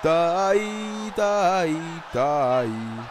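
loud, stretched goal shout; goal celebration. Real, not acted. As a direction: roar the goal out long.

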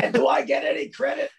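high, loud smug squirrel voice; cigar-smoking squirrel grumbling about credit. Pretend. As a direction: gloat like a pampered squirrel.

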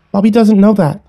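put-on high child's retort; defensive little sister. A narrator's reading. Little sister excuses friend to sibling, defensive. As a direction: answer back in a little girl's high voice.

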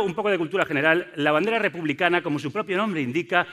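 usual speaking voice

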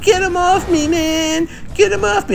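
very high, squeaky imitation of a frantic player; mocking a panicked scream. Mocking the enemy player begging teammates, very high mock scream. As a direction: mimic the enemy's panic in a shrill mock yelp. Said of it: "He's screaming in the mic".

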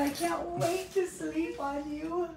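ordinary talk, nothing marked

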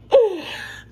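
sharp, high gasp of excitement; excited gasp. Real, not acted. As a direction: gasp before the big news. Big news reveal to viewers.

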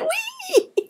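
very high squealed whee of delight; gleeful whee. Acted. Little mouse thrown up by daddy, high gleeful squeal. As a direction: squeal like you're tossed in the air. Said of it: "he went".

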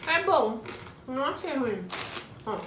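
speaking with food in mouth; talking between bites of chip; chewing while talking. Real, not acted. Seen 1: Woman holds chip, cheeks and lips show chewing while speaking.

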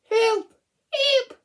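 very high, loud shouts for help; cry for help. Acted. Frightened animal screaming for help.

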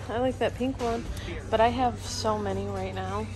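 ordinary delivery

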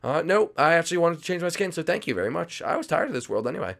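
lightly voiced reverse psychology bluff; fake-cheerful sidekick. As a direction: bluff that you wanted this all along.

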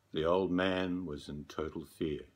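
plain reading voice

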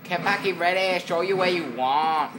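put-on high, loud Vietnamese-accented announcer; mock Vietnamese accent. Gamer echoing the care package call, high mock Vietnamese accent. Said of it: "doing the Vietnamese accent".

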